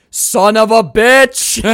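high, loud, drawn-out shout; weird Nicolas Cage-style exclamation. Retold or imitated. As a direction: belt it out slowly in an overblown impression. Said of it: "weirdest way anyone's ever said that phrase".

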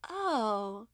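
put-on high kid voice; a small child pausing to think; imitating the kids. Kids stopping to recall who taught them, high kid voice. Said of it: "they're like".

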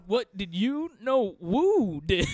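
slow, put-on tough guy; thug voice. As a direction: drawl it like a dim thug.

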